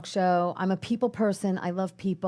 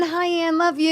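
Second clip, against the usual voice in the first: calling out; 8 semitones higher than usual. Real, not acted. Shout out to a viewer.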